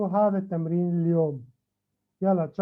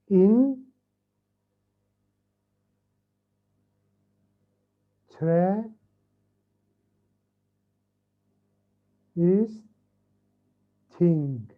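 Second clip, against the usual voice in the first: "In tre is thing" one at a time, long pauses between; broken into syllables; 3 dB louder, much slower than usual. Shown as a demonstration. Teacher showing the four syllables together, each said separately. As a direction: count out "In tre is thing" as four isolated beats. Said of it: "four syllables".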